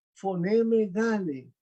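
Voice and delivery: "foneme dale" read slowly, syllable by syllable; slow Greek reading. Shown as a demonstration. Teacher reading Greek to the class, slow and careful. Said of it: "nosotros decimos un grito grande".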